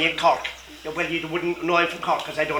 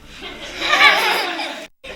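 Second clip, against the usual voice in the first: high laugh. Real, not acted. Storyteller laughs at her tale.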